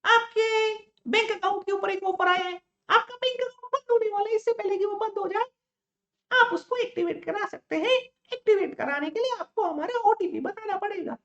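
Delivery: sweet fake lady from the bank; man doing a woman's voice. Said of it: "लड़की की आवाज में phone करा जाएगा".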